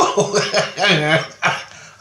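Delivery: high laugh at own story; amused laugh. Real, not acted. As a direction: laugh right after your own punchline. Laughs at own retold answer.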